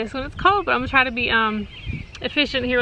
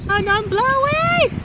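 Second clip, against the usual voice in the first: surprised cry; 13 semitones higher than usual. Real, not acted. Paper blowing in wind.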